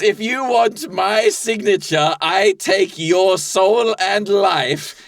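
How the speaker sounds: hammed-up villain offering a deal; menacing soul dealer. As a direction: lay out the deadly bargain slowly and theatrically. Plays deal-maker in improv scene.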